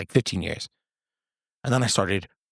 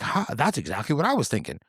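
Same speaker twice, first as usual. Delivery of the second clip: mildly mimicked friend, pitched higher; surprised agreement; 6 semitones higher than usual. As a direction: lightly act out an impressed buddy agreeing.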